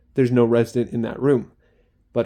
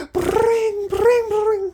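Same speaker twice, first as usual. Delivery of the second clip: much higher, louder mock bell ring; imitated alarm ring; 4 dB louder, much slower than usual. Imitating the usual fire alarm, a high mock ringing. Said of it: "a fire alarm noise is like".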